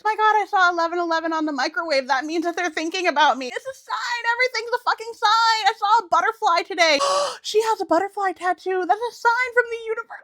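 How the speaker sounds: very high, giddy mocking voice; mock sign-obsessed fan. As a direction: gush over every little sign.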